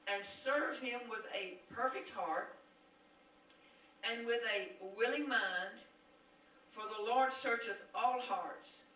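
loud, declaiming preacher's reading; scripture read with force. Acted. Preacher reading scripture to the congregation, declaiming loudly. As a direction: proclaim each line to the congregation.

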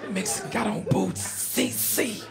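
hushed, secretive calling; voiceless code-word murmur to passers-by; covert whisper. Retold or imitated. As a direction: whisper it around as you search for someone. Discreetly asking round for C C.

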